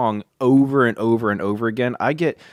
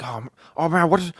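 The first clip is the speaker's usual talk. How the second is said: higher-pitched mock voice of a buddy; Fonzie impression; 6 semitones higher, faster than usual. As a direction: imitate your buddy, pitched up. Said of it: "if you don't know how he talks".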